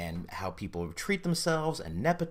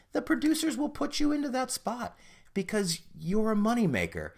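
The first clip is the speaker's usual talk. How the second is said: heated rant; 9 semitones higher than usual. Real, not acted. Ranting about Hollywood producers.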